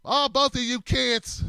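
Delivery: usual speaking voice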